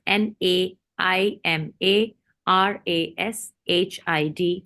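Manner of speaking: slow, letter by letter; spelling out. Real, not acted. Host giving the guest's website, spelling it out slowly.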